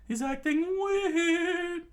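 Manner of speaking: very high, slow and loud; high, drawn-out figure voice; Sanji character voice. A narrator's reading. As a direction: squeal it out slowly. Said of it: "Sanji said".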